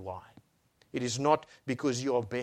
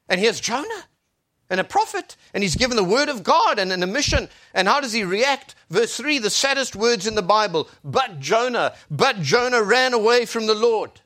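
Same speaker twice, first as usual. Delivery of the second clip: loud, higher preacher's delivery; impassioned preaching; 10 semitones higher, 10 dB louder than usual. Real, not acted. Preacher declaring God's word to the congregation, impassioned preaching. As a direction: proclaim it with full force.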